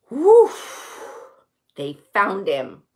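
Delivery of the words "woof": loud exaggerated sigh of relief; hammed-up relieved "whoof"; big sigh. A narrator's reading. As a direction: gasp in, then blow out hard. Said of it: "they sigh big sighs".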